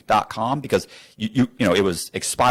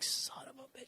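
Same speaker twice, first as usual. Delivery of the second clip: a disbelieving curse under the breath; whispered curse; 22 dB quieter than usual. Retold or imitated. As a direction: hiss it out, beaten. Agent can't believe it.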